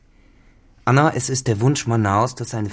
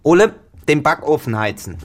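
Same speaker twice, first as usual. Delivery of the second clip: raised, higher call across the house; 6 semitones higher, 6 dB louder than usual. Acted. Mistress calling to the servant Ole, a raised call.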